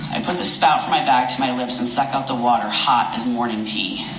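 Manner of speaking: ordinary delivery, nothing marked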